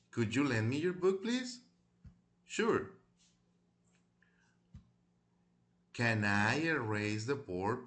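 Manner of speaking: careful teacher's model reading, questions lifting at the end; deliberate language-class dictation voice; read-aloud pronunciation model. Shown as a demonstration. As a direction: read each phrase aloud clearly for pupils to repeat. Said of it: "lo voy a leer en voz alta".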